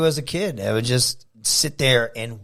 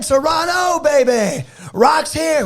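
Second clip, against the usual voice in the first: much higher shouted catchphrase, hyping the crowd; The Rock; 13 semitones higher, 5 dB louder, slower than usual. Retold or imitated. Rock greeting the Toronto crowd, shouted high. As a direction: bellow it out to a roaring arena. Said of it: "he said".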